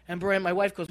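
ordinary talk, nothing marked

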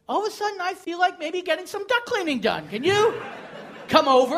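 mock eager. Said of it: "I'm like".

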